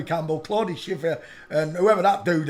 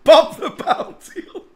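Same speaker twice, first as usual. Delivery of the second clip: higher, louder, laughing through the name; 10 semitones higher, 6 dB louder than usual. Real, not acted. Mocking the bounty hunter's wife, laughing. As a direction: giggle the name out. Laughing at her own joke.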